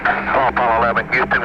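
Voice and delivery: ordinary delivery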